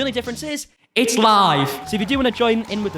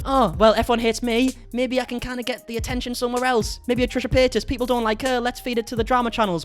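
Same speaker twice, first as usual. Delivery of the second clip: lightly voiced scheming celebrity, higher; a hint of a panicked plotter; mock inner monologue; imagined Shane's thoughts; 5 semitones higher than usual. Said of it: "in the sense of".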